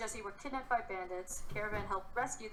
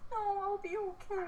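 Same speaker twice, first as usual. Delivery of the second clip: whiny fake innocence; 12 semitones higher than usual. Mock pleading in roleplay.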